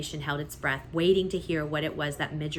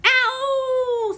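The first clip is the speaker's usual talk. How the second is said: high, loud rat scream of pain; 9 dB louder than usual. A narrator's reading. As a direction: shriek in pain. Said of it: "screamed Roscuro".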